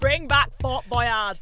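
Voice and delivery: slow, loud chant; playful drawn-out shout; rallying cry. Pretend. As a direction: bellow it like a campaign slogan. Mock rallying cry for old show.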